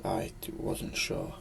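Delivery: breathy hushed whisper, slowing down; scared whisper. A narrator's reading. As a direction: whisper it as if afraid to be heard in the dark. Fear rising on dark stairs.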